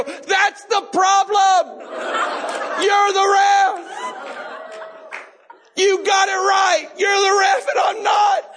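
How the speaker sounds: high, slow full-voiced shout; drawn-out, high-pitched screamed retort; yelling back. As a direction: holler it back at the ref. Acted yelling back at the ref.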